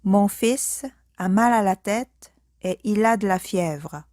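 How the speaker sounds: ordinary talk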